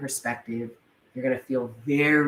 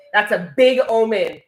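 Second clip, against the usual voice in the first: exultant shout; 9 semitones higher, 4 dB louder than usual. Real, not acted. Exultant proclamation over the omen.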